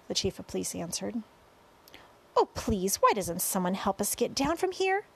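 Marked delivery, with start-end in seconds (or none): none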